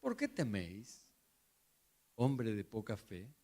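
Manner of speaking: very soft, tender question; soft gentle rebuke. Pretend. Jesus calmly reassuring the frightened disciples. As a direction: ask it gently and kindly. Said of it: "En Reina Valera".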